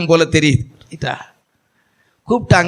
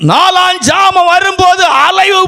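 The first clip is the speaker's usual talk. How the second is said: high, loud preaching shout, slow; fervent shouted sermon; preaching at full force; 13 semitones higher, 3 dB louder, slower than usual. Acted. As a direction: proclaim it, crying out.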